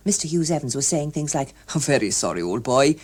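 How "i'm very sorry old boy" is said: lightly voiced hearty man; a hint of a jovial official; lightly voiced cheery official. Hughes Evans voiced.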